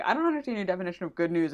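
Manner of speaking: fast, flat Cass quote; quick mock Cass; rattled-off Cass quote. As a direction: rush through the Cass line. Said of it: "when Cass is like".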